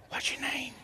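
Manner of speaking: hushed, voiceless whisper; secretive whispered question. Retold or imitated. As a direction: whisper it discreetly, barely audible. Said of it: "I whispered, I said".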